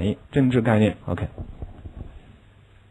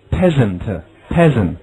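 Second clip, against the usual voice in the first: very slow, deliberate repeat; slow model reading; much slower than usual. Shown as a demonstration. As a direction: stretch "Peasant" for learners. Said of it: "next one".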